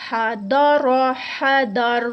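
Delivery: حدر drawn out very slowly, with a full heavy ra; loud, very slow recitation. Shown as a demonstration. Teacher reciting حدر with a heavy ra. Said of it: "اس کو ہم موٹا ہی پڑھیں گے".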